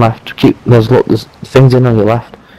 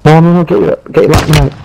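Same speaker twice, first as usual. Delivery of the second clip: panicked; 7 semitones higher, faster than usual. Real, not acted. Panicked call to teammate mid-fight.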